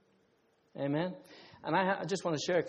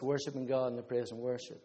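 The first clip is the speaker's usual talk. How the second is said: usual speaking voice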